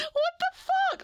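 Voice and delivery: very high, loud disbelieving cry; real stunned yell; shocked shriek. Real, not acted. Second shocked outburst.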